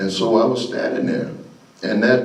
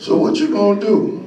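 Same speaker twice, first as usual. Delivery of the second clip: higher-pitched retold challenge; challenging question; 10 semitones higher than usual. Coach asking boy to decide, pitched-up challenge. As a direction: put the choice to him, pitched up.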